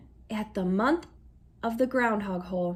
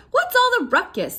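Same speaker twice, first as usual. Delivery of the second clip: gruff grandfather; 11 semitones higher, 6 dB louder than usual. A narrator's reading. Grandfather wakes up.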